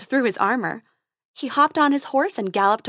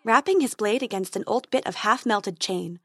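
ordinary delivery

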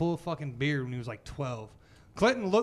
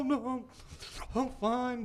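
slightly raised imitation of a tearful kid; mock crying child; 5 semitones higher, 3 dB quieter, slower than usual. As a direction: play an upset child insisting, lightly. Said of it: "He's crying".

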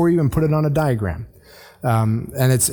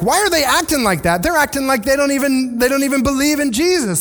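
mock indignant churchgoer; 8 semitones higher than usual. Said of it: "we come to church and we're like".

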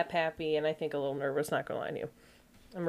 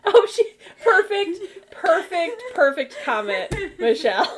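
laughing; 9 semitones higher, 6 dB louder than usual. Real, not acted. Laughing hard at comment.

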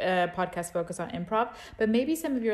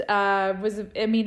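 usual speaking voice

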